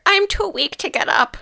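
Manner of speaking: put-on very high thin old lady voice; frail grandmother. Grandmother from bed, high frail voice. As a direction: sound too weak to stand.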